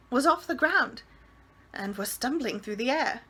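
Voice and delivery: ordinary delivery, nothing marked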